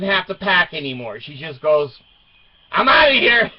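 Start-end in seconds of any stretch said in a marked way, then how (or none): 2.7-3.5 high, loud, fed-up exit line; huffy wife leaving. Retold or imitated. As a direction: storm out in a huff. Wife voiced storming off.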